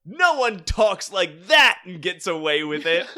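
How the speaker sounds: loud, high mock-heroic outrage; defiant, bullheaded. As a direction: declare it like an overacting hero. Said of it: "as fucking defiant and bullheaded".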